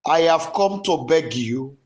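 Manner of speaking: passionate repentance prayer that swells into shouts; fervent prayer. Shown as a demonstration. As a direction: cry out to God for pardon, building and falling. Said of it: "Go ahead and pray".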